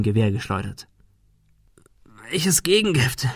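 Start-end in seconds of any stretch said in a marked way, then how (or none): 2.3-3.3 higher-pitched question through pain; understated strain of a wounded man. A narrator's reading. Thomas, just shot, asking about the antidote, pained. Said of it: "fragte er unter Schmerzen".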